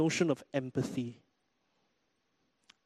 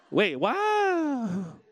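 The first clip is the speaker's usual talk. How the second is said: high-pitched, drawn-out "what"; mock double take; 7 semitones higher than usual. Preacher mimics stranger hearing "terrible", high confused double take. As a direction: imitate a stranger suddenly baffled. Stranger registers the unexpected answer.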